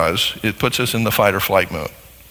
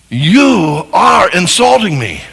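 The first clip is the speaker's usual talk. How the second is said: slow, loud, offended; hammed-up hurt shout; mock angry accusation; 6 semitones higher, 7 dB louder, slower than usual. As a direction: accuse the spouse of insulting you. Spouse quarrel, acted accusation.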